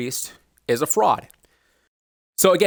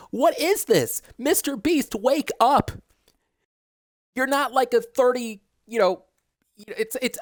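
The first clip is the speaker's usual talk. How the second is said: much higher, exasperated scolding; frustrated rant; 8 semitones higher than usual. Real, not acted. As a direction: vent your anger straight at him. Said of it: "I'm getting mad at the fact that".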